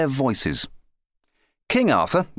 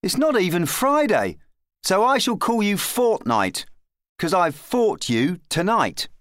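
put-on high-pitched boy claiming his prisoner; triumphant lad; 9 semitones higher than usual. A narrator's reading. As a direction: declare victory as a small boy. Boy taunts the beaten knight.

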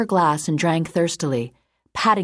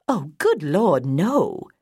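sounding horrified; much slower than usual. A narrator's reading. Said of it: "sounding horrified".

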